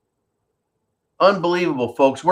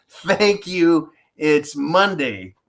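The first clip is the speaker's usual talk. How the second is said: ordinary talk, nothing marked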